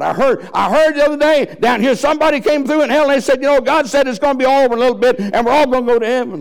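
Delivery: high, loud, fast excited gossip from hell; a frantic soul in hell spreading hopeful news; gossiping damned soul. Acted. Soul in hell passing rumor.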